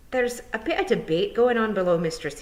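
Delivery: hedging shyly; a hint of a blushing pixie; embarrassed young pixie.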